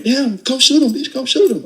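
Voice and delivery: high, urgent yelling during a struggle; retold yell. Pinned under gunman, yelling to his girl to shoot. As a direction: yell for help mid-fight, pitch way up. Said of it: "I'm yelling at him".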